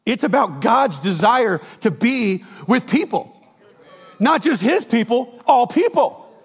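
high, loud, slow stressed climax; emphatic preaching. Real, not acted. Pastor driving home his main point to the church, emphatic. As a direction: lean into each word, building.

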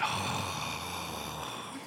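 long low demo sigh under the breath; exasperated sigh. Shown as a demonstration. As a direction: sigh like you're fed up. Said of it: "It's a sound we make when we're exasperated".

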